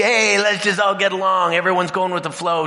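singsong everyone-get-along voice; sing-song pseudo cheer. Retold or imitated. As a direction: sound falsely cheerful. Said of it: "pseudo community".

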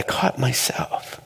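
slow confessional whisper; breathy aside to the crowd. Real, not acted. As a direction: let it drop to a hushed admission. Confessing quietly to audience.

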